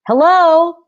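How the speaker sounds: loud, high, carrying call; shouted hello. Acted. Soldier shouting a greeting at a stranger, loud. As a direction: shout it across the distance. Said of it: "he shouted".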